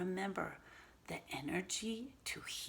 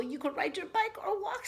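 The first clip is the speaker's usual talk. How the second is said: Robert's voice; 13 semitones higher than usual. Acted. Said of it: "said Robert".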